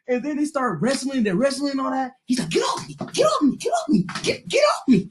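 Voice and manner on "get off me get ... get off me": high, repeated get off me, each one climbing; panicked get off me. Retold or imitated. Pinned kid begging to be let go, high panic.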